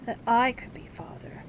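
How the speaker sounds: whispered, shy wish; humble boy whispering his wish; said differently. Acted. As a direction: breathe it out as a timid hope. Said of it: "Toodles said differently".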